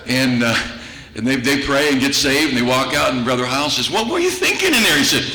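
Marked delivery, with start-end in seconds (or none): 3.9-5.0 much higher, disbelieving question; a pastor who can't believe what he just saw; incredulous pastor. Retold or imitated. Pastor scolding the nervous convert, high incredulous question.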